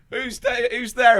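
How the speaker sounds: mock Tony. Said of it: "Tony will be going".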